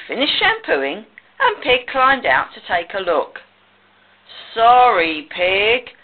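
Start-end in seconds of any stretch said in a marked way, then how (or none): 4.5-5.9 mildly sheepish, drawn-out sorry; sheepish apology. A narrator's reading. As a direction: apologise with a touch of embarrassment. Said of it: "said Sheep sheepishly".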